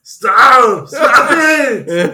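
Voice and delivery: high, loud action star yell; hammed-up agonized Austrian shout; Arnold Schwarzenegger. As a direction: yell it like an overwrought action hero. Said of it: "hit you with the Arnold".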